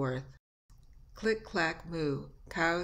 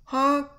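loud, high horn honk; sudden honking shout; imitated honk; 8 semitones higher, 10 dB louder than usual. Shown as a demonstration. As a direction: blast the word like a horn. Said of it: "Machine noises".

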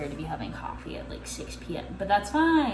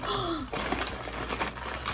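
sharp breathy gasp of surprise; excited gasp; 8 semitones higher, 9 dB quieter than usual. Real, not acted. Unboxing coffee, a gasp of surprise. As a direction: gasp as the box reveals itself. Surprised while opening the package.

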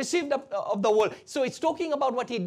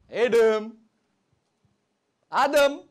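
loud call to Adam; God calling out; 6 dB louder than usual. Acted. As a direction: call out as God seeking someone. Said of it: "God cried out to Adam".

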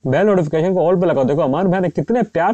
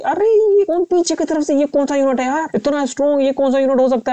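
much higher alarmed cry; shocked by the unit behind; alarmed surprise; 9 semitones higher than usual. Real, not acted. Gamer shocked by a strong enemy unit from behind, high alarmed cry.

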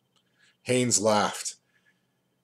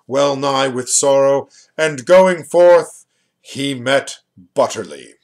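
grave words; 2 semitones higher, 8 dB louder than usual. A narrator's reading. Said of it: "with grave words and gait".

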